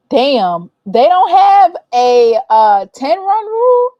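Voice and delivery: high, loud outraged question, slowed down; loud disbelief. Real, not acted. Vlogger shocked there is no ten run rule, loud disbelief. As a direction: cry out at a lopsided score.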